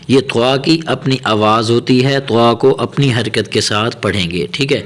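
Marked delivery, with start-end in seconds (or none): none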